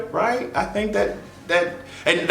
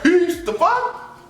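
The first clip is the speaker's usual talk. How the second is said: slow, disbelieving higher voice; baffled; much slower than usual. Retold or imitated. Reacting to a kid walking in, slow baffled disbelief. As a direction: stretch it out in disbelief. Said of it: "I'm like".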